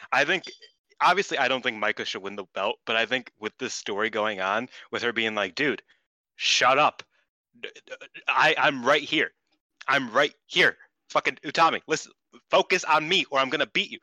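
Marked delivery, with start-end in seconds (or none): 5.6-14.0 angry rant. Retold or imitated. Acting out Micah's rant.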